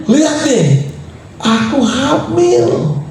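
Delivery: high, drawn-out retold cry of joy; slow, high-pitched showing off; triumphant announcement. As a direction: stretch it out high, playing the thrilled wife. Wife shows she is pregnant.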